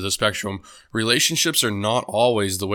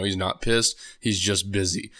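ordinary delivery

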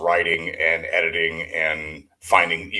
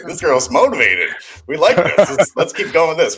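excited; 9 semitones higher, 5 dB louder than usual. Retold or imitated. Excited about eager volunteer.

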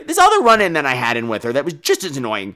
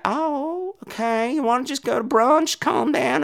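higher, softer coaxing voice; soothing husband; 8 semitones higher, 5 dB quieter, slower than usual. Retold or imitated. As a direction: gently placate him. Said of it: "He's like".

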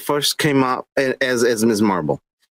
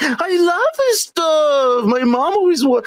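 put-on girly voice; 8 semitones higher than usual. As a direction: gush like an excited teen girl. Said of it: "the chick is like".

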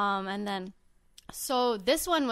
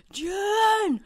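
high, loud, drawn-out call of a name; imitated concert yell; 11 semitones higher, 6 dB louder than usual. Fan imitates friend caught yelling on video, high yell. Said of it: "I'm just yelling".

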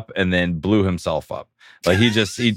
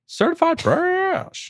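mock squeaky voice; slower than usual. Mock reading a username.

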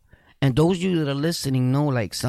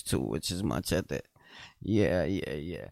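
mumbled aside; 11 semitones lower, 10 dB quieter than usual. Real, not acted. Trailing aside to himself.

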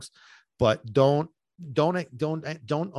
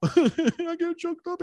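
laughing it out, much higher and slowed; half-laughing at his own emotion, pitched way up; much slower than usual. Real, not acted. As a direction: giggle through the words at yourself. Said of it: "I get excited".

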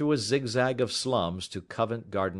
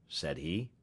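ordinary delivery

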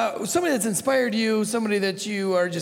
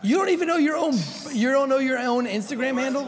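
incredulous; 7 semitones higher than usual. Real, not acted. Teasing a guest over his handle.

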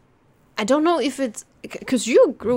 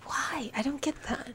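whispering; 3 semitones higher, 9 dB quieter than usual. Real, not acted. Quiet puzzled aside.

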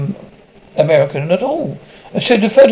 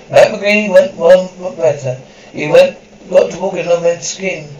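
measured narrator summing up the lesson; closing narration. A narrator's reading. Narrator closing the episode: measured summing up.

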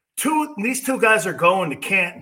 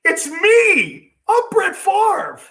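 high, whining mock quarterback voice; mocking a sulking quarterback; whiny Favre impression; 10 semitones higher, slower than usual. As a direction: whine it like a moping star who feels unloved. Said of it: "Favre was like".

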